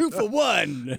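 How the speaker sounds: high, hugely stretched club DJ sign-off; milk every word like a club announcer; strip club announcer. Shown as a demonstration. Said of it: "great strip club announcer voice".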